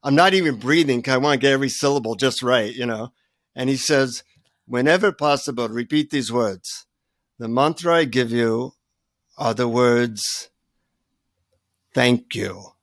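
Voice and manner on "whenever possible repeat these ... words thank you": impart a secret mantra as an Indian guru; solemn Indian guru, slowing with long pauses; Indian-accented guru. Acted. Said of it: "he leans in to whisper in my ears".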